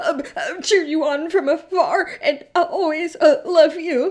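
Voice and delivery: high, loud, laughing fake-sob voice; hammed-up blubbering ex-boyfriend; mock whiny ex-boyfriend. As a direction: ham up a teary ex. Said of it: "They go".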